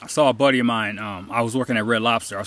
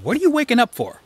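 high-pitched, challenging question; nagging inner voice; 10 semitones higher than usual. Acted. Voice in your mind questioning you every morning, nagging. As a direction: needle the listener with a raised pitch. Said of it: "a voice in the back of your mind asking you".

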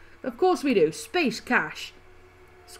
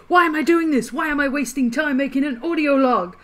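mock panicky guy; 4 semitones higher, 6 dB louder than usual. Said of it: "I like the way the guy's like".

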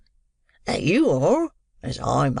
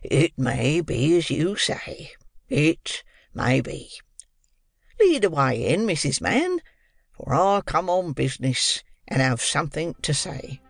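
calmer; slower than usual. A narrator's reading. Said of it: "in a calmer tone".

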